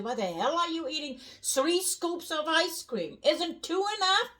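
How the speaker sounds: high-pitched, indignant mock outburst; blunt scolding. German acting out scolding someone over ice cream, blunt. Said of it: "we are extremely blunt".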